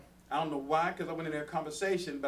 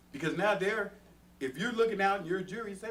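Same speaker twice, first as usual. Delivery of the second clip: ordinary talk, nothing marked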